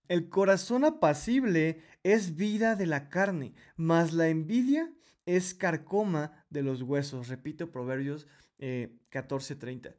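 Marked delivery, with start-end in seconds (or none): 0.1-7.3 scripture reading. Acted. Reads Proverbs aloud.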